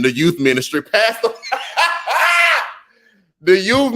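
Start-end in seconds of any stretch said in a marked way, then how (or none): 1.3-2.7 very high burst of laughter; real laugh. Real, not acted. Laughing at the "Pastor" joke, a very high burst of laughter. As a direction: crack up.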